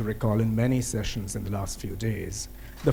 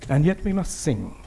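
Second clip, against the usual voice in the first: poem recitation; 3 dB louder than usual. Acted. Poet reciting a protest verse on Kashmir, solemn declamation. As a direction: push each refrain out firmly, then let it drop.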